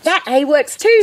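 high, mildly shouted delight; a storybook shout, high and pleased; excited shout. A narrator's reading. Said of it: "shouted Quentin".

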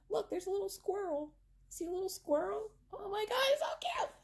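high, squeaky delighted gushing; high cute voice. Real, not acted. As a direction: coo over something adorable. Cooing at the tiny squirrel.

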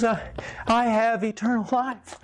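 high, wondering, close to tears; he's just grasped it for the first time; stunned joy, voice lifted high; awed realization. Retold or imitated. Man's tearful moment of realization.

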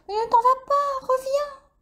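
very high pleading bat voice; begging him to stay; pleading Dolly. A narrator's reading. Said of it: "implora Dolly".

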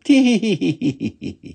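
high tee-hee giggle; tittering laugh. Acted. Funny little woman who liked to laugh, high tittering. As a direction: giggle in a high, rippling run. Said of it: "who liked to laugh".

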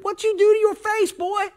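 very high-pitched startled question; startled uncle. Acted. Uncle spotting the boy's burnt face, startled. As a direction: squeal it in comic disbelief. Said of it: "my uncle got in the truck".

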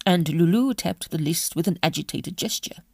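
ordinary delivery, nothing marked

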